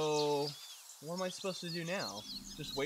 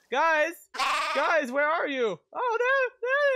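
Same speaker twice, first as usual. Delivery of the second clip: high, loud shouts for the missing crew; playful high-pitched yelling into the distance; calling out; 11 semitones higher, 11 dB louder, slower than usual. Pretend. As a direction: holler after your lost guys. Calling out to lost game characters.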